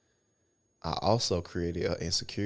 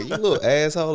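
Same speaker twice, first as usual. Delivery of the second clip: mock scolding; 9 semitones higher, 9 dB louder than usual. Retelling scolding a kid.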